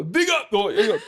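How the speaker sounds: high, loud Jamaican patois call. Pretend. Showing off his Jamaican patois, loud and high. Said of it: "a little patois".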